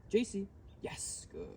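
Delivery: soft slow whisper to the dog; whispered praise. Real, not acted. Trainer praising her dog quietly, whisper. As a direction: breathe the praise without voice.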